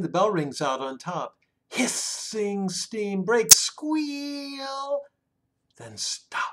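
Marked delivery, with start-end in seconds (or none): 1.7-5.0 train noises. A narrator's reading. Reading the train book aloud, acting out the squealing brakes. 5.8-6.4 hushed whispered stop. A narrator's reading. Reading to a child as the train stops, whispered.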